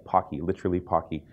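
Pocky said "POCK-ee", rhyming with "rocky"; pronounced as spelled. Shown as a demonstration. Presenter linking name to the candy, Pocky as "POCK-ee". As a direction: say both Pocky with an open "ah". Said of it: "that's spelled P O C K Y".

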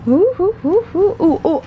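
excited oohs. Real, not acted. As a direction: coo with excitement at the find. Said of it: "I was excited about this".

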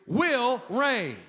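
a room-filling proclamation, WILL hit hardest; loud group declaration. Real, not acted. Pastor leading the congregation in a loud declaration. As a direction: shout it out together, strong on WILL. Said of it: "Say this with me".

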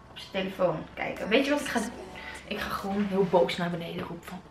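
hushed whisper to the camera. Real, not acted. Girl plotting a prank on her dad, whispering. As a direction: whisper the plan so dad won't hear.

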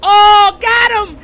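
high, loud victory yell; triumphant shout. Real, not acted. Player yelling as they catch the opponent.

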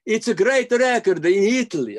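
high, loud put-on Italian accent. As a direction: ham up an Italian accent, big and bright. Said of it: "They're okay with me doing the accent".

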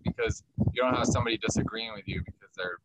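ordinary delivery

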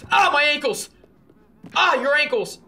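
loud, high yelp; mock pain cry. Player falling with companion, mock pained yell. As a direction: cry out as if hurt.

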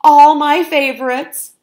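high, loud, gushing praise; fawning. A narrator's reading. Fox fawning over the cook's feast, high and loud. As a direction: gush over it loudly. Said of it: "fawned Floyd".

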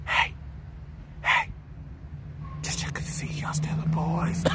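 a lightly voiced whispered quote from his brother; imitating his brother. Vlogger quoting his friend's greeting to the boys, mock whisper. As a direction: pass on his brother's hushed check-in, half-whispered. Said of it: "In the words of my brother from another mother".

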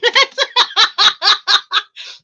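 long high burst of laughter; helpless laugh. Real, not acted. Streamer cracking up at Lex's super chat, helpless laugh. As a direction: laugh loud and freely.

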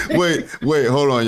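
usual speaking voice

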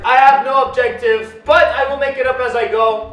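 hyped video intro. Acted. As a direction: sell the challenge to viewers. Starts a challenge video intro.

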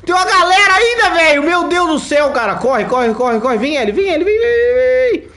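panicked high screaming, running for it; being chased. Real, not acted. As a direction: yell in real panic, calling Ellie along. Enemies chasing in the game. (gritando em pânico, sendo perseguido)

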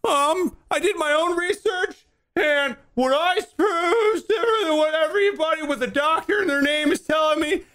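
high, mocking know-it-all voice; mock conspiracy theorist. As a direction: parody a smug self-researcher. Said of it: "tinfoil hat of like".

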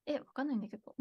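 baffled whisper. Real, not acted. 問題が解けずに小声でつぶやく. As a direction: whisper it, stumped. Stuck on the puzzle.

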